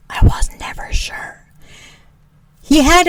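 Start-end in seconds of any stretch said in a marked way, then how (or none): 0.1-1.4 breathy confession under the breath; whispered aside. Real, not acted. Admitting to the camera she was never sure, hushed. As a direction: let the truth slip out in a hush. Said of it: "confessing aside".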